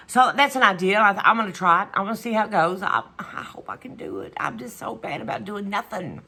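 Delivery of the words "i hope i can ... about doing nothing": half-voiced whisper to herself; a quiet confession in a hushed whisper; whispered aside. Real, not acted. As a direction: mutter the doubt under her breath. Private aside after stating a plan.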